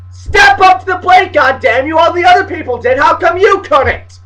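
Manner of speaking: loud, high scolding of the losers; indignant shout. Pretend. Fan berating Wofford and Belmont for not winning, indignant shout. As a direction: holler a fed-up challenge. Mock rant at losing teams.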